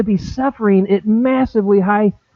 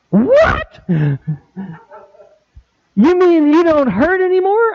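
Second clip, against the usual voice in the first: incredulous; 11 semitones higher than usual. Retold or imitated. People react to healing claim.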